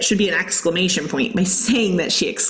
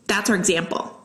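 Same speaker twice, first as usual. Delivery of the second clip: ordinary talk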